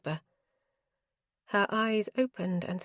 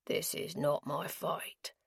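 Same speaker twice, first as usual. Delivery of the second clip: lower, slower and softer; low gravelly voice; 3 semitones lower, 10 dB quieter, slower than usual. Acted. Gambler refusing to give up his coin, low. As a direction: rumble it low and slow. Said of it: "in a low, gravelly voice".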